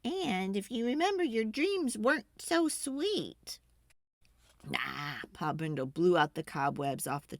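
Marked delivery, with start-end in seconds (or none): 0.0-3.6 Ma's lightly voiced grumble, slowing at the end; a hint of a grumbling mother warning Pa. A narrator's reading. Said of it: "Ma grumbled".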